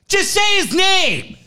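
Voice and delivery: high, slow, pleading hiss; exasperated plea. Retold or imitated. Screener losing it at Ted, slow exasperated plea. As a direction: beg him to get it right. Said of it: "I'd lose my shit".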